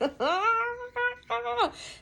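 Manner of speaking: a high, breathy laugh; high burst of laughter. Real, not acted. Laughing about getting kicked out of VIP. Laughing at her own story.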